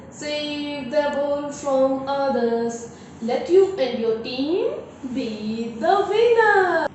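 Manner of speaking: slow chanted verse with spaced-out beats; measured classroom rhyme, words held long; poem recitation. Shown as a demonstration. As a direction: recite in singsong, drawing out line endings. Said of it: "I'm going to recite the second poem".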